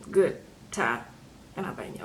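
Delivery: low, soft, doubtful "good time"; dry, trailing-off second thought; skeptical aside. Real, not acted. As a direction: drop it low as if taking it back. Doubting her own words after "Well.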